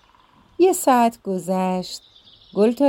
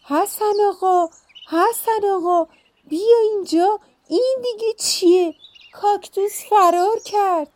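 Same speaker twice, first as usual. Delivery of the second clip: frightened shout; 12 semitones higher than usual. Acted. Wife screams to her husband about the runaway cactus, high panicked shout. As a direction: shriek for help, startled out of her wits. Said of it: "فریاد زد".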